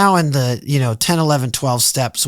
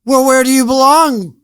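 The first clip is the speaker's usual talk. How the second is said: mock taunt; 4 semitones higher, slower than usual. Mock inner voice questioning himself.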